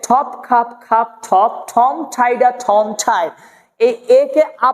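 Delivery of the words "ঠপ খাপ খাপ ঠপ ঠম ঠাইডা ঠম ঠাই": ঠপ, খাপ, ঠাই with airy stop releases; aspirated T and K. Shown as a demonstration. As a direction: puff air on every ঠ and খ in ঠপ, খাপ, ঠম. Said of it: "এভাবে পড়তে হবে".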